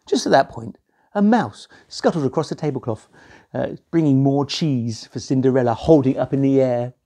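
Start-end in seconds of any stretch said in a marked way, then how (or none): none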